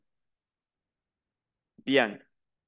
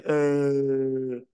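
dopey drawn-out "euh", then two short ones; mock a silly mistake with a dumb "euh"; imitating a classroom jeer on a dull vowel; schoolkids' mocking "eh"; much slower than usual. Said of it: "alguien hacía alguna bobada".

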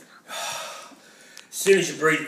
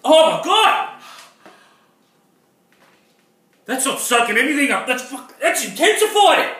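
higher, louder pained yelling; 10 semitones higher, 6 dB louder than usual. Real, not acted. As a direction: yell through burning mouth. Mouth burning from chili.

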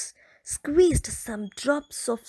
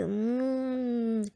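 ordinary delivery

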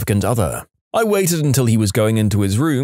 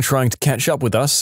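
ordinary delivery, nothing marked

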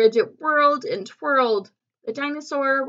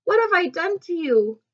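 high-pitched, mildly distressed question; a touch of storybook dismay; dismayed cry; 7 semitones higher than usual. A narrator's reading. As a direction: worry over what you did. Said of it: "cried Bridget".

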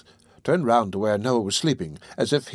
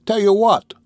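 high, loud, peevish question; pettish; 10 semitones higher, 7 dB louder than usual. A narrator's reading. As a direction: snap it peevishly. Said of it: "shaking himself pettishly".

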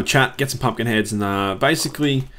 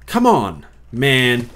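exasperated protest; 4 dB louder, much slower than usual. Real, not acted. Player protesting a bad enchantment, exasperated.

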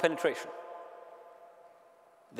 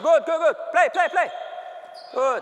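loud, higher coach cheers; shouted encouragement; 5 semitones higher, 4 dB louder than usual. Real, not acted. Coach shouting encouragement to defenders. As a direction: yell praise across the court.

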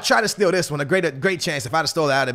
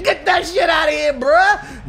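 mock goalkeeper shout; 9 semitones higher than usual. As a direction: bark it like a cocky goalie swatting the ball away. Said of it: "Keeper saying".

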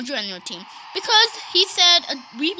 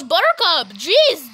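ordinary talk, nothing marked

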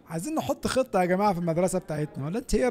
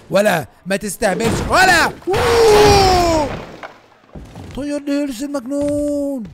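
angry shout; 6 semitones higher, 6 dB louder, much slower than usual. Real, not acted. Fight with student, shouting.

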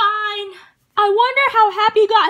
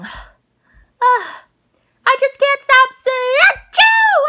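ordinary talk, nothing marked

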